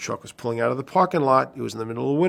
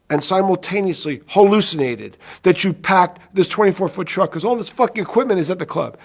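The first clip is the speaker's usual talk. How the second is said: angry; 6 semitones higher, 4 dB louder than usual. Retold or imitated. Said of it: "And I said".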